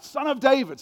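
brief high shout; crying out. A narrator's reading. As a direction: call it out sharply over a crowd. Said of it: "cried out".

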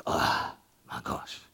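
breathy exhausted groan; worn-out sigh. Retold or imitated. Tired worker flopping on the couch, breathy groan. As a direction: sag like you're spent after the week.

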